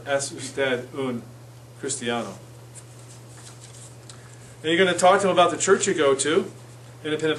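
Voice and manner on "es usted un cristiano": "Es usted un cristiano" slow, dropping softer. Shown as a demonstration. Teacher slowly models Spanish question, soft and slow. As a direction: spell it out slowly for beginners. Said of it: "you can do that if you don't remember".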